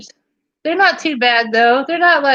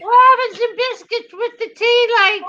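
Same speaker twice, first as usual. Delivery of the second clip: high teatime fake British; silly, pitched-up British hostess; terrible mock British; 9 semitones higher than usual. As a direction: ham up an awful British accent. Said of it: "best, worst accent ever".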